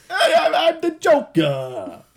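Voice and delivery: high, drawn-out silly villain voice; Joker. Acted. Co-host mocks the villain's only line, high slow hammed-up voice. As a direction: squeeze it up high and drag it out. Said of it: "all of his lines are just".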